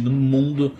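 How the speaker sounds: ordinary talk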